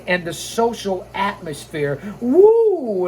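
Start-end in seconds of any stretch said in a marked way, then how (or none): 2.2-3.1 high, loud Flair-style woo; Ric Flair woo. Retold or imitated. Host imitating Ric Flair's signature call, loud woo. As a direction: let out the trademark woo. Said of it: "as only he can do".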